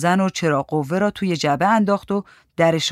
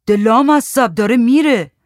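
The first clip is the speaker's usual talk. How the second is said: loud, high exasperated shout; 6 semitones higher, 7 dB louder than usual. A narrator's reading. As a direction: yell it at him, pitched up. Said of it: "وحید داد زد".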